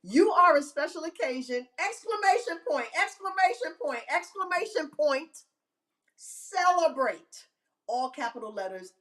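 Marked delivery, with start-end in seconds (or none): 0.0-1.7 exclaimed high, like text in capitals; raised, emphatic announcement; all caps. Retold or imitated. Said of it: "All caps". 1.8-7.5 high, emphatic punctuation read-out; high-pitched celebratory announcement; all caps. Retold or imitated. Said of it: "All caps".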